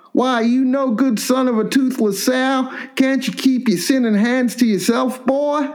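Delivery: put-on high, slow cranky old man scolding; hammed-up hillbilly dad yelling at his son; angry old hillbilly pa. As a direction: rasp out a hunchback father's rage. Old Pa voiced in story.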